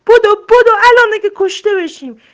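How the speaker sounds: very high, very loud cry to run; panicked yell. A narrator's reading. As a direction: scream to flee for your life. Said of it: "خرگوش داد زد".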